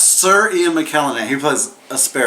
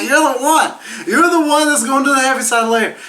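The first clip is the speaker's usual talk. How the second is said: high excited voice; 12 semitones higher than usual. Retold or imitated. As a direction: announce it up high. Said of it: "she's like".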